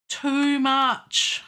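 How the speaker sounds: exclaimed. Real, not acted. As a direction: blurt out too much at the mistake. Repeats correction to himself.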